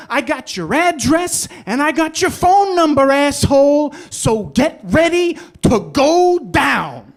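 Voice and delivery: high, loud, acted-out threatening rant; furious mobster. Parking garage owner phoning the cab driver, furiously threatening. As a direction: tear into them as an enraged small-time Bronx mobster. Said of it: "This is how I know he wasn't too happy, because this is what he said".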